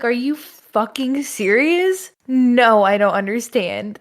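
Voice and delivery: mock exasperated disbelief; hammed-up indignation; losing it. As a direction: play at being fed up and incredulous. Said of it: "I would literally lose my shit".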